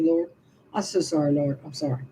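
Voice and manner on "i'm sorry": ordinary delivery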